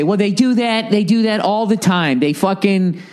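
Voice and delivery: high whiny mock objection; lightly voiced know-it-all audience; mocking the listeners. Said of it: "I know you guys are gonna say".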